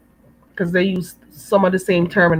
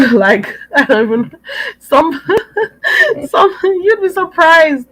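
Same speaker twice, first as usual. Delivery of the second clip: high-pitched, breaking up with laughter; laughing; 9 semitones higher, 5 dB louder than usual. Real, not acted. Speaker tells listeners they'd be surprised by the accents, laughing. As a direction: talk through helpless giggles.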